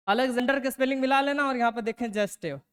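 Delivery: ordinary talk, nothing marked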